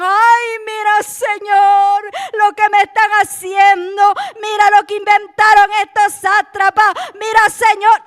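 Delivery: loud, high-pitched mock complaining to God; mock lament. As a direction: wail it like a put-upon whiner. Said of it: "él no iba a lamentarse".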